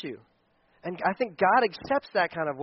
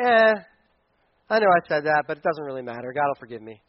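careless shrug; 5 semitones lower than usual. Retold or imitated. Pastor mocking a careless churchgoer, low dismissive mumble. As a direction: shrug it off like it doesn't matter.

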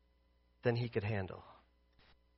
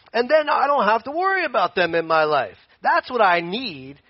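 raised, higher voice of comic complaint; mock protest; 3 semitones higher, 4 dB louder than usual. Believer haggling with God over what they need, mock-insistent. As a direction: argue back insistently, getting louder. Acted argument talking back to God.

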